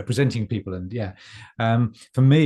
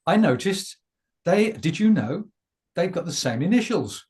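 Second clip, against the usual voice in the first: show host voice; 10 semitones higher, 4 dB louder than usual. Acted. Introduces guests on the show.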